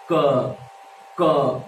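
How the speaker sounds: "क" alone with a sharp release of air; isolated "क", air hitting the palm; puff of air. Shown as a demonstration. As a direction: push "क" out in one jolt of breath. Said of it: "ऐसे बोलो".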